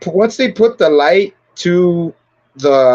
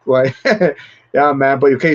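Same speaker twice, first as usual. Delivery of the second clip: ordinary talk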